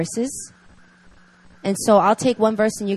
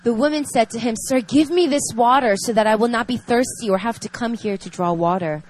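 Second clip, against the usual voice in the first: reading scripture; 4 dB louder than usual. Acted. Reads scripture passage aloud.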